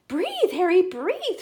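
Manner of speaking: high-pitched urgent cry; alarmed shriek. A narrator's reading. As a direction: shriek it, loud at the start. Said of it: "shrieked Missus Logan".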